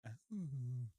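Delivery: loud, stretched "oh"; pained giant groan. Acted. Giant wiping his hand on his eye, loud acted groan. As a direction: moan it out big as the giant.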